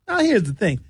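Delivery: ordinary delivery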